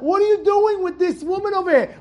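high-pitched, indignant scolding; angry rebuke. Retold or imitated. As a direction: voice Moses angrily rebuking his men. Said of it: "Moshe Rabbeinu gets angry at them".

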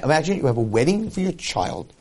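normal talking voice

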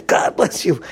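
high, loud shouted blessing; full-throated mock preaching; evangelical preacher. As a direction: shout it like a revival minister. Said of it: "being an evangelical minister".

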